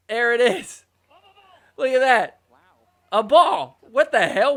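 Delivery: loud, high startled outburst; shocked commentator; surprised. Real, not acted. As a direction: react to the sudden ball. Surprise at a stray ball.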